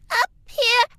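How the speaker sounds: high cartoon-kid placement; Lil's high placement. Shown as a demonstration. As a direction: lift the voice up high like Lil. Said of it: "Lil is".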